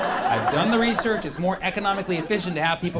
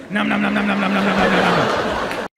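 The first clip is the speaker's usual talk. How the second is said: munching noises voiced loud; greedy eating sounds; loud chomping noises; 7 dB louder than usual. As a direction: chomp through the ribs noisily. Acting eating ribs noisily.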